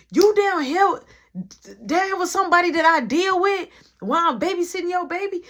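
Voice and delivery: high, loud accusing yell; light into her for leaving; angry confrontation. Retold or imitated. Tamika yelling at Jamie for abandoning her baby.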